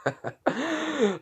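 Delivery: high wheezy acted laugh; acted fit of high chuckling; character's laugh. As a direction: laugh as the amused beast. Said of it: "Kurama just laughs".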